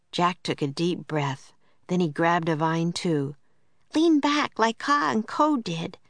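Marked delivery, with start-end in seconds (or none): 3.9-6.0 put-on high, bright little girl voice; Annie's voice. A narrator's reading. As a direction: voice an eager young girl, pitched up. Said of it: "said Annie".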